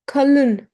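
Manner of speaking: kalın with a schwa-like ı; dotless ı. Shown as a demonstration. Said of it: "It's not E, it's I".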